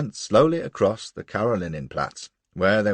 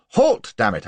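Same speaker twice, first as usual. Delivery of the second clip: loud barking command to halt; angry shout; 3 semitones higher, 7 dB louder than usual. A narrator's reading. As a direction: yell at the coachman to stop. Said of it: "I shouted".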